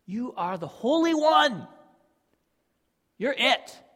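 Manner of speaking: loud, high declaration of faith; fervent prayer. Retold or imitated. Habakkuk praising God in trouble, fervent and loud. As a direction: proclaim it with full conviction. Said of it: "he even starts it with".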